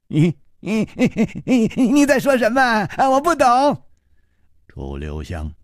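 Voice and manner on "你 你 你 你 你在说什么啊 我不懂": high, giddy madman; feigned lunatic answering Chu Liuxiang, high and giddy; madman's voice. Acted. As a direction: play the childish lunatic, bouncing. Xue Baobao's madman voice.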